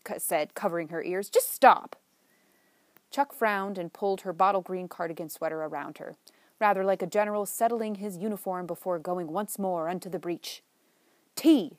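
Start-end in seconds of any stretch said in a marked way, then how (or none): 1.3-2.0 loud, high plea to make it stop; desperate, cry out for it to stop; pleading cry. Acted. Frightened friend begs Chuck to stop, loud high cry. Paige begs, covering her ears. 11.4-11.8 a loud, high booming call. Acted. Chuck announces tea to end the talk, loud booming. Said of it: "in her deep, booming voice".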